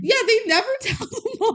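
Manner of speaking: high, laughing exclamation; laughing agreement. Real, not acted. Co-host agreeing that nobody told the mom, laughing. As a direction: burst out laughing through the words.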